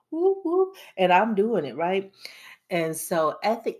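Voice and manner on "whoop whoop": high, loud playful cheer; whoop. Real, not acted. Vlogger cheering her treat days, high loud whoop. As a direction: cheer yourself on. Celebrating indulgence.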